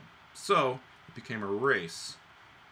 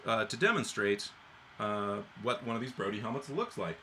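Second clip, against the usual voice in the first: usual speaking voice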